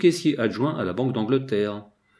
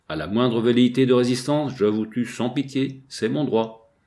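plain reading voice

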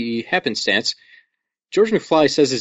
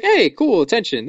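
high-pitched imitation of a flattered girl; mock pleased interest; 7 semitones higher than usual. Imitating Lorraine when someone's talking to her, mock pleased interest. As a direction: pipe up high, pleasantly intrigued. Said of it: "she's just like".

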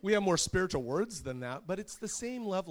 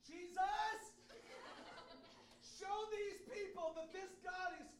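put-on child's yelled prayer; 11 dB quieter than usual. Daughter praying at a neighborhood shrine, high distant yell.

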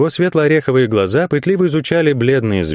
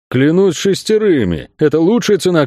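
high, fervent assurance; insistent, higher-pitched protest; emphatic insistence; 7 semitones higher than usual. A narrator's reading. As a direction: swear to it, pitch raised. Friend insists on his deal.

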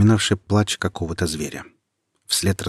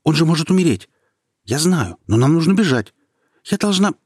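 anxious urgent plea; 10 semitones higher, 6 dB louder than usual. A narrator's reading. Nika urges escape, worried for brother.

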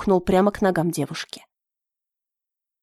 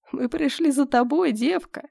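higher, slow wheezing menace of a dark monk; hoarse monk; 4 semitones higher than usual. Acted. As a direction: wheeze out the claim like a sinister monk. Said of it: "прохрипел монах".

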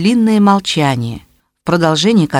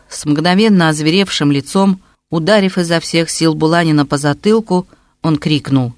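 ordinary delivery

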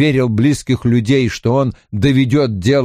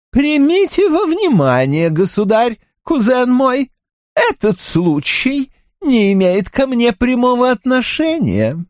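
countess voice; 13 semitones higher than usual. Acted. Mahaut replies calmly.

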